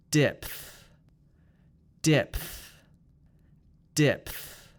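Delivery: "Depth" with p released into th; PTH ending. Shown as a demonstration. Teacher models for repeating, "Depth" with clear p plus th. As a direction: end "Depth" on a breath-pushed pth. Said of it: "Let's now do P T H".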